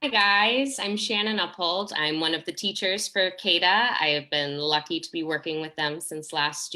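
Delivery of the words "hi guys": ordinary talk, nothing marked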